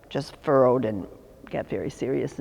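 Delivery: ordinary talk, nothing marked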